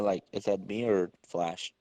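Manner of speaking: ordinary delivery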